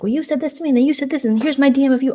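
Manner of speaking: high-pitched, rapid-fire mock accusation; tattling back-and-forth. Accusers reporting what was said to them, mock tattling. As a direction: mimic people piling up accusations.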